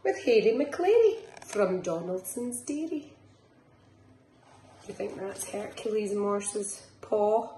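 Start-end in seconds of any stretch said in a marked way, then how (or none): 0.0-3.1 lilting picture-book reading; reading to children. Acted. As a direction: bounce the rhymes along for little ones. Reads picture book aloud.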